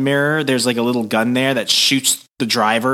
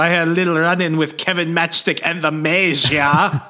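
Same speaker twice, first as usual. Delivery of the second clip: put-on German accent; 4 semitones higher than usual. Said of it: "I had to do that".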